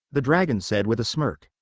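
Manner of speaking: ordinary delivery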